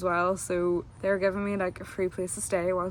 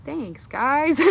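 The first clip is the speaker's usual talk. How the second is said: sheepish thanks; 7 semitones higher, 7 dB louder than usual. Retold or imitated.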